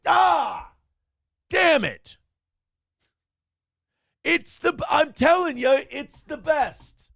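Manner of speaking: high, strained whine of frustration; mock anguish. To April, mock-whining that his bits are the best.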